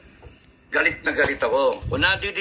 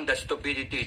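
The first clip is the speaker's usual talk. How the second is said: usual speaking voice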